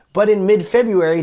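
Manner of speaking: normal talking voice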